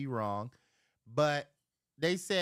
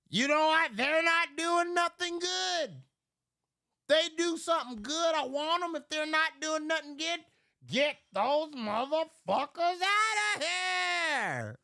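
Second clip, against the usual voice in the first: retold rant; 11 semitones higher than usual. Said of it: "I told him".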